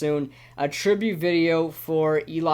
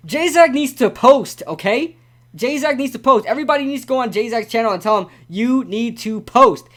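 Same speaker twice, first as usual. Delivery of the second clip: higher, louder, insistent plea; emphatic demand; 6 semitones higher, 4 dB louder than usual. Real, not acted. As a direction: hammer it home for everyone listening. Emphatic public plea to J-Zach.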